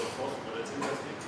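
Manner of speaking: normal talking voice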